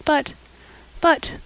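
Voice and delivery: hesitant, halting start; mildly hesitant girl; faltering. Acted. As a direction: falter over the words with pauses. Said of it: "faltered Anne".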